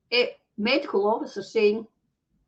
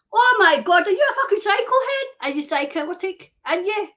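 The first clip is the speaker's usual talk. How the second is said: much higher, louder, fast accusing yell; furious outburst; 6 semitones higher, 4 dB louder than usual. Real, not acted. Raging at officials, higher fast furious yell. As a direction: hurl the insult at them.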